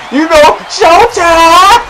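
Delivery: very slow high drawn-out call; showtime hype. Real, not acted. Boasting to viewers after scoring, hyped. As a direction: stretch showtime like an announcer. Celebrating own bucket.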